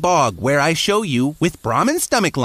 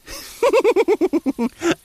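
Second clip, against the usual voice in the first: high laugh cracking up mid-line; breaking into laughter; 12 semitones higher than usual. Real, not acted. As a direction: burst out laughing out of character. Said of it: "I couldn't keep a straight face".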